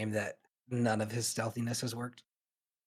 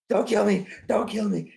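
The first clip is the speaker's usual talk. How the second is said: high, frantic mock begging; panicked pleading; 10 semitones higher, 5 dB louder than usual. Startled dwarf begging the stranger behind him, panicked pleading.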